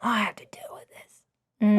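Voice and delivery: muttered, whispered complaint; whispered mock grumble; grumbling whisper. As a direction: grumble it under your breath like mom. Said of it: "It's like".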